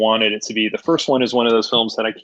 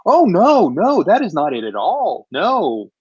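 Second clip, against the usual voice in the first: dismayed reaction; 3 semitones higher, slower than usual. Retold or imitated. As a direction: reject it with exaggerated disappointment. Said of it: "I'm like".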